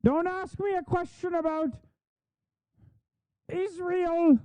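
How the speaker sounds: high soft parody voice dodging questions; mocking yokel voice; mock folksy politician. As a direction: sneer as an evasive yokel.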